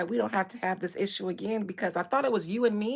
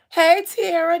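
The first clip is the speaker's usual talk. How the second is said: excited greeting; 6 semitones higher, 9 dB louder, slower than usual. Real, not acted. Greeting chat member.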